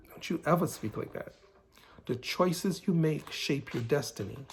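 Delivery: ordinary talk, nothing marked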